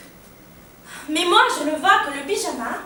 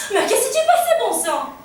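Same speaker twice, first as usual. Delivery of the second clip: high, agitated burst, then dropping; very nervous; 7 semitones higher, 4 dB louder than usual. Acted. Nervous father demands to know what happened, agitated and high. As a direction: blurt it out in nervous exasperation. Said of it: "très nerveux".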